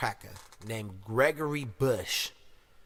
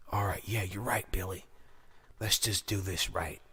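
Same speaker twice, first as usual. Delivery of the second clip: subdued partner; 6 semitones lower, 5 dB quieter than usual. Acted. Acts the partner giving in.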